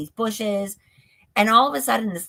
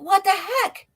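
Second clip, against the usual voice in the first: startled disbelief; 10 semitones higher than usual. Retold or imitated. Retold startled reaction in dream.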